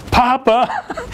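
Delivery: high, held child's call, imitated; grandson's yell. Grandson summoning his grandfather from bed, imitated high yell. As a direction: mimic a boy calling out for grandpa. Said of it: "and here he goes".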